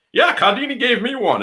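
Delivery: high-pitched mock one-upping; imitated casual brag. Host imitating his friend topping him about a card deck, casually bragging. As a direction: toss off the boast in a high voice.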